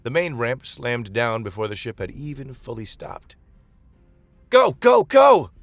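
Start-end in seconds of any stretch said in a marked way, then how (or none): 4.5-5.5 booming loudspeaker shout, much higher and louder. Acted. Mara booming at evacuees over ship's speakers. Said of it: "Mara's voice boomed over the ship's external speakers".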